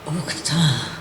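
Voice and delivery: whispered uktan, no voice at all; hushed whispered uktan in the chant. Shown as a demonstration. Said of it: "in a whisper".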